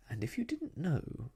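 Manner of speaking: ordinary delivery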